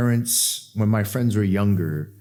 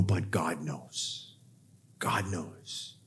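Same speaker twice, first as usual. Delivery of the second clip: very soft, low and slow; hushed assurance; 7 semitones lower, 14 dB quieter, much slower than usual. Retold or imitated. Young self consoling a friend, hushed assurance. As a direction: let it sink to a reverent near-murmur.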